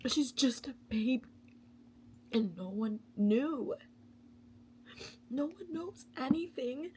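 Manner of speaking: high, teary voice breaking between laughs and sobs; crying. Real, not acted. Vlogger overwhelmed by a book's child abuse, crying. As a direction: cry through the words, half laughing. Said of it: "I'm crying".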